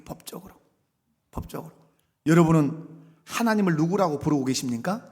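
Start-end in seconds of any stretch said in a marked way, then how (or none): 0.0-1.7 법적으로 repeated in a soft whisper, fading away; whispered echo. Shown as a demonstration. Pastor echoing himself to the congregation in a hushed whisper. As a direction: breathe 법적으로 twice, barely voiced. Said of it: "법적으로 아들이에요".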